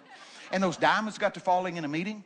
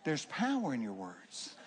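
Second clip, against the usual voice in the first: softer; 4 semitones lower, 6 dB quieter, slower than usual. Shown as a demonstration. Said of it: "I'm telling you".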